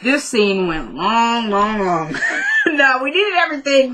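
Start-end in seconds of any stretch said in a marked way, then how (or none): 0.9-2.2 LONG stretched out slowly on each repeat; drawn-out LONG. Real, not acted. Complaining about a scene that went long, LONG drawn out. 2.4-3.9 higher-pitched, laughing protest. Real, not acted. Host joking about an overlong scene, laughing.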